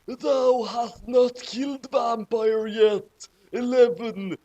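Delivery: hammed-up angry dark lord; angry villain. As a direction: drag it out like a pompous dark lord. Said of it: "he said angrily".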